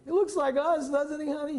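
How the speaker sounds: high, loud doting falsetto; mock-tender new father in a high voice; gushing husband. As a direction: coo back at your wife about the baby. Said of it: "I said".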